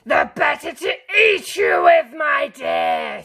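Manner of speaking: huge loud snarling shout; wolf lunging for the kill; loud snarling roar; snarled. A narrator's reading. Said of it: "snarled the wolf".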